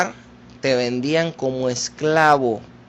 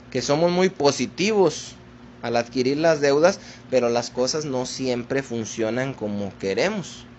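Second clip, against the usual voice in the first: normal talking voice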